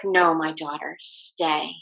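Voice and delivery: soft, low-key tender command; quiet, kindly and unhurried; gentle voice. Retold or imitated. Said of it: "in the most gentle voice".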